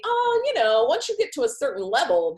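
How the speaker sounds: put-on airy dismissal, starting high; mocking excuse. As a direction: parody someone brushing it off. Mimics a dismissive excuse she rejects.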